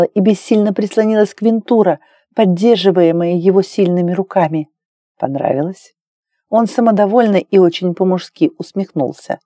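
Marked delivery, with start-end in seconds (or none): 5.2-5.9 low, self-satisfied man's question; lightly voiced smug lover. A narrator's reading. Lover asks Claire afterwards, low and lightly smug. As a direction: ask low, pleased with yourself.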